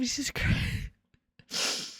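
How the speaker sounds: slow breathy whispered sigh of a curse; exasperated whisper. Real, not acted. Streamer sighing over her yawning, slow exasperated whisper. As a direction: breathe it out slowly, worn out.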